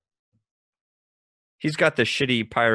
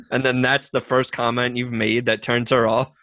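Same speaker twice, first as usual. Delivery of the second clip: ordinary delivery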